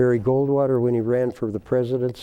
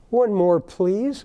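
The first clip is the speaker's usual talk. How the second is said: high, slow pleading as the dog; lightly voiced dog asking for one more; dog begging; 6 semitones higher, much slower than usual. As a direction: beg sweetly like the dog. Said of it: "he just said".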